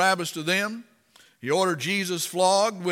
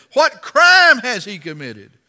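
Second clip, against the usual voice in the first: loud, slow, raised challenge; demanding; 5 semitones higher, 13 dB louder than usual. Acted. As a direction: demand it of the crowd, drawing the words out. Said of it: "Pilate demanded".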